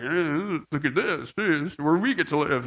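mock smug boast, kept low. Driver boasting about where he lives, mildly smug. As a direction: lightly imitate a man showing off. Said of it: "he's kind of boasting".

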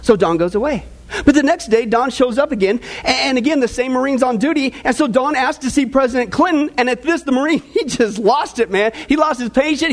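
usual speaking voice

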